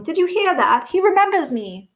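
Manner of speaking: high delighted announcing; thrilled boasting. A narrator's reading. Daedalus Diggle tells the whole pub, high and thrilled. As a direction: share the thrill with everyone.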